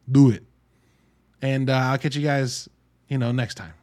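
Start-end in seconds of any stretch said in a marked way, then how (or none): 0.1-0.4 louder, punchy command; loud urging. Real, not acted. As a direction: push them to go see it. Urging listeners to watch.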